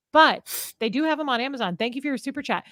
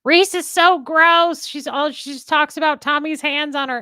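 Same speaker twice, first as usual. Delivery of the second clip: raised, louder voice of an outraged online critic; mock disgusted commenter; 4 semitones higher, 6 dB louder than usual. Host imagining an evil post about herself, mock disgusted. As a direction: exaggerate the indignant complaint, drawing out the opening. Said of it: "somebody to post something evil".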